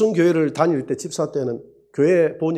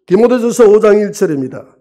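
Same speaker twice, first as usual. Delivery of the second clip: loud announcement; 4 semitones higher, 8 dB louder than usual. Acted. Pastor announcing the Bible passage, loud. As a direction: call out the verse to the congregation.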